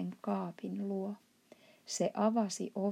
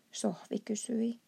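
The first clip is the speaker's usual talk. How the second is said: ordinary delivery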